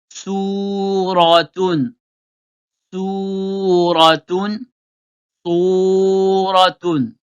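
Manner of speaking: "Suratun" drawn out very slowly, syllable by syllable; teacher modelling "Suratun" very slowly for pupils to repeat; "Suratun" stretched out, each sound clear; Arabic pronunciation model. Shown as a demonstration. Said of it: "On remplace le sou par le so".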